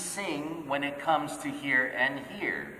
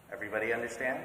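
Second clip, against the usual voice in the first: normal talking voice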